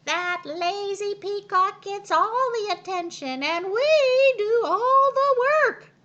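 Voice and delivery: very high, very loud hen yell. A narrator's reading. Hens yelling that they do all the work. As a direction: yell it out in a huff, hen style. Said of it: "yelled the hens".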